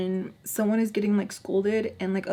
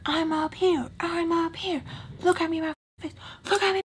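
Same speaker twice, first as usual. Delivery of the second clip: high-pitched imitation of a scolding; demanding mock scolding; 9 semitones higher than usual. Imitating Tommy ordering Spice to look up, high demanding scold. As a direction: mimic someone demanding attention, pitched way up. Retold boss demanding eye contact.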